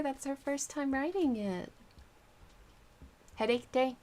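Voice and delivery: usual speaking voice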